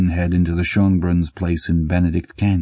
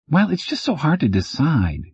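higher, sugary innocent act; fake sweet; 9 semitones higher than usual. A narrator's reading. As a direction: play it sweet to fool him. Faking innocence to the guard.

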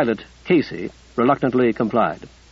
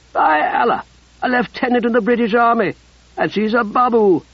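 much higher, mocking laugh in the voice; scoffing soldier; 9 semitones higher than usual. Ruddy mocks the British lieutenant, high and scornful. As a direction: jeer at her rank.

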